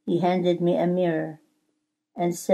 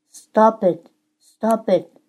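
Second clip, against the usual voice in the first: raised, higher pleading cry; cried out; 5 semitones higher, 5 dB louder, slower than usual. A narrator's reading. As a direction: cry out twice in protest, narrated. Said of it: "I cried".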